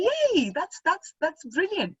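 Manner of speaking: high, swooping retold cheer; delighted cheer. Telling friends she loves their sari idea, delighted. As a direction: cheer them on, pitched well up.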